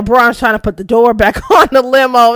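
ordinary talk

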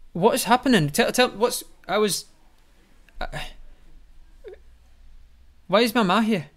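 high, loud, bewildered; alarmed shouting. Acted. Apis shouting at his mother in confusion. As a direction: cry out, lost and scared.

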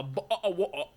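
"bottle of water" with all the Ts swallowed; mock British pronunciation. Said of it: "no one said".

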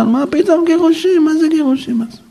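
high, incredulous questioning. Real, not acted. Rabbi scoffing at divorce, high incredulous. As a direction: scoff at the very idea.

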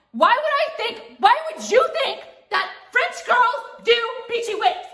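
exasperated, can't-believe-you question; incredulous. Real, not acted. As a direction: stretch it out in disbelief.

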